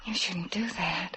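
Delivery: soft whispered half-scolding; hushed weak reproach; whispered protest. Acted. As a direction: whisper it, not meaning it. Protesting after a kiss.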